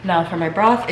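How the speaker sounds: usual speaking voice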